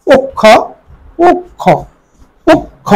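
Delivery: পক্ষ high, as "pok... kho"; careful split pronunciation. Shown as a demonstration. Teacher repeats পক্ষ for students, broken after "pok". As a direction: pause on the doubled k in each পক্ষ. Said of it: "প ওকার".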